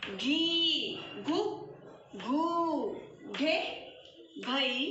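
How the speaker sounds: quick rhythmic chant of the syllables; sing-song recitation. Shown as a demonstration. The teacher leads the children in reading the barakhadi aloud as a chant. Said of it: "अब मैं आपको इसे पढ़ना बताती हूँ".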